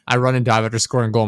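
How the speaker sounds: normal talking voice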